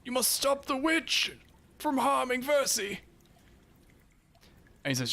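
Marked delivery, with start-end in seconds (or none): 0.0-3.0 put-on high, thin old man's voice, imploring; high-pitched, urgent plea for help; pleading old man. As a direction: beg desperately as a frightened old man. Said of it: "you hear the old man behind him go".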